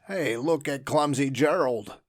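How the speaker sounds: loud jeering taunt; mock him loudly; sneering. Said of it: "the animals all sneered".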